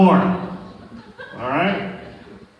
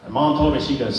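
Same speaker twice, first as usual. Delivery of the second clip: normal talking voice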